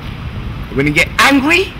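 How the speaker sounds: ordinary delivery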